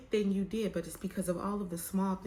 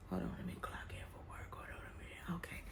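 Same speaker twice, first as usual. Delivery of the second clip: hushed whisper, quick at first; whispered aside; 13 dB quieter, faster than usual. Real, not acted. Vlogger pausing her story to clock in for work, whispered.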